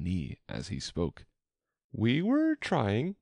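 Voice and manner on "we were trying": high, slow humble explanation; drawn-out, high, meek voice. A narrator's reading. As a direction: explain yourself meekly to the queen. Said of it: "in a very humble tone".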